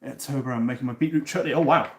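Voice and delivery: usual speaking voice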